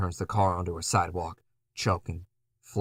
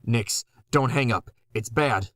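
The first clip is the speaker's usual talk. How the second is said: urgent plea; 4 semitones higher, 5 dB louder than usual. Acted.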